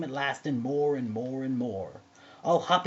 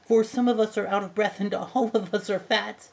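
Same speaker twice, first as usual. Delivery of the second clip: loud, higher pleading oysters; crying out; 6 semitones higher, 7 dB louder than usual. A narrator's reading. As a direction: keep calling out, raised and loud. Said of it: "the oysters cried".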